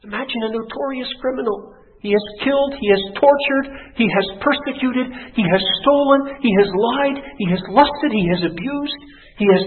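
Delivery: high, chant-like list of charges; fervent pulpit recitation; impassioned preaching. Acted. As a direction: ring out each item in the same rising pattern.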